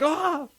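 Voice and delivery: very high mock yelp; imitated burn cry. Cop touching a hot furnace, mock pained. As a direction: yelp as if burned, in jest.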